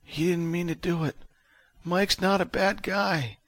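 high, slow, feeble voice; Vic. A narrator's reading. Injured man defends his attacker, weakly. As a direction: plead weakly, dragging it out. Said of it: "but he still spoke".

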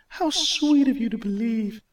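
very high, sweet goddess voice; loving, nurturing. Acted. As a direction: coo at him like a mother. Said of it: "in a loving, nurturing tone".

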